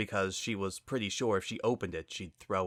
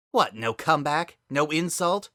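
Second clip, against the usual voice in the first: higher, louder taunting challenge; mocking taunt; 7 semitones higher, 6 dB louder than usual. Spitfire taunting her silent sister, higher and louder mockery. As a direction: needle her for staying silent.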